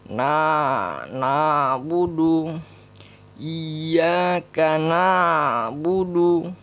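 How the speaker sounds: loud, drilled na, na, budu; pronunciation demo. Shown as a demonstration. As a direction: sound out each syllable firmly. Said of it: "Kayak gitu ya".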